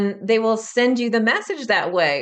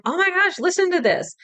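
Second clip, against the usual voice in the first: squealing much higher, thrilled; excited to a friend; 10 semitones higher than usual. Retold or imitated. Showing a New Zealand friend the recording, excited high squeal. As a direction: gush it like you can't believe it. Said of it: "I'm like".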